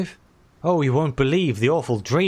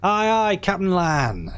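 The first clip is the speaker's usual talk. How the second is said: rousing pirate crew cry; hearty crew shout; 8 semitones higher, slower than usual. Acted. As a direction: answer the captain with gusto. Crew answering the captain.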